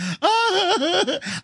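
high, drawn-out fake wail; mock sobbing. Kid sobbing at the movie, hammed-up high wail. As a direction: act out sobbing too hard to speak. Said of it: "And I'm freaking sobbing".

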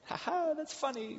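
very high, strained fake chuckle; forced fake laugh. Manager forcing a laugh at a cruel joke, high and fake. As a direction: pretend it's funny, squeezed high.

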